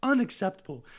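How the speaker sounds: high, offended mocking voice; mock high-pitched indignation; indignant drunk lady. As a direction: play her huffy outrage. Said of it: "And then she was like".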